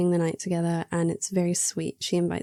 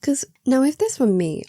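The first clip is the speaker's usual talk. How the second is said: ordinary talk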